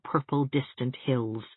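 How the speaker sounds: usual speaking voice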